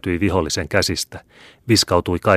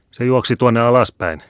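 higher, louder narrated shout; 6 semitones higher, 6 dB louder than usual. A narrator's reading. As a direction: call out urgently to the others. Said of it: "huusivat toiset". (korkealla äänellä huutaen)

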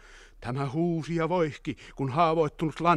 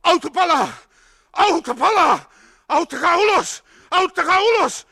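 high, loud shout of alarm; 10 dB louder than usual. A narrator's reading. Crawling soldier crying for help in the burning car, shouting. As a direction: yell desperately for rescue. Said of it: "Joku ryömi hänen jaloissaan ja huusi".

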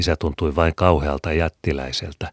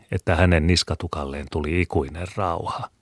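plain reading voice

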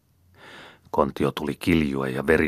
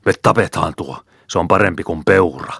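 louder, eager hunting shout; 3 semitones higher, 6 dB louder than usual. Acted. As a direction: yell it out to your partner, keen for the kill. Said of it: "heti karjaisi". (innokas huuto)

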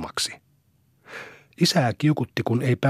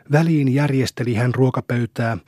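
usual speaking voice

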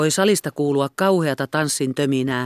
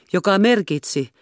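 ordinary delivery, nothing marked

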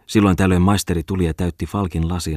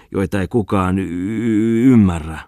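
tipsy stammer; 2 semitones higher, 4 dB louder, slower than usual. Acted. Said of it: "pehminyt toimittaja".

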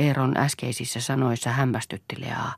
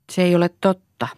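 high, loud firm denial; 6 semitones higher, 5 dB louder than usual. A narrator's reading. Boy contradicting his father, firm. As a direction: deny it squarely, voice raised. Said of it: "sanoi Poju lujasti".

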